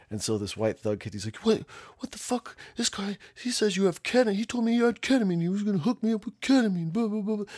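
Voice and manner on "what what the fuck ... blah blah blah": very high, hurried mock whining protest; raised-voice outrage. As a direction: imitate an indignant kid, pitched way up. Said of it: "he's raising his voice about ketamine".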